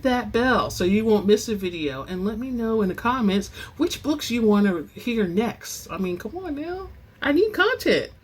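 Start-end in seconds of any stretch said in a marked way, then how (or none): 5.9-8.1 playful plea. Real, not acted. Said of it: "playful plea for views".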